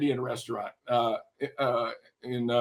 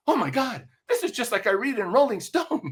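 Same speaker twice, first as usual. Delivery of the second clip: raised, wide-eyed amazement; awestruck; 7 semitones higher than usual. Retold or imitated. College rep staring down at the band, awestruck. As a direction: gush like a starstruck kid. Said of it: "His eyes, his eyes are open, like, pie-eyed".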